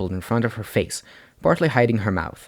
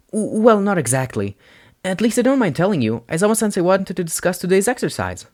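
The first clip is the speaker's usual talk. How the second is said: put-on higher, stammering flustered boy; nervous boy voice; 10 semitones higher, 3 dB louder than usual. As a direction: stumble nervously into it. Izuku stammers.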